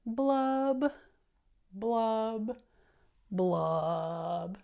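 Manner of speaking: loud popping blubs, falling at the end; fish blub sounds. Shown as a demonstration. Reader shows the class the fish's loud blubbing. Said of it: "Can you do that? Ready?".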